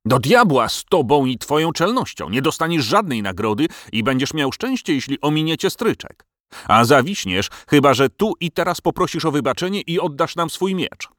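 angry royal threat; boiling anger. A narrator's reading. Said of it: "Król znów zakipiał z gniewu".

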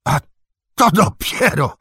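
high, loud burst of surprise; exclaim, pitch jumping right up; astonished exclamation. A narrator's reading. Old Cotton recognises Sam, exclaiming in amazement. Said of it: "wykrzyknął".

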